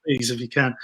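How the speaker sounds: a light imitation of a wrestling manager, starting high then easing down; lightly voiced wrestling manager, politely agreeing; Jimmy Hart. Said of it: "in his voice".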